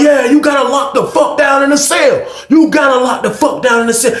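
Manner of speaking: high, heated yelling; hammed-up quarrel; acted shouted order. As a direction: order him around at a high pitch.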